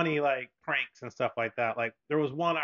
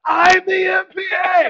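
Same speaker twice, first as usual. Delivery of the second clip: much higher, loud and slow prankster yell; gleeful shout; 13 dB louder, slower than usual. Retold or imitated. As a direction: hammer the punchline home at full volume. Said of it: "And he goes".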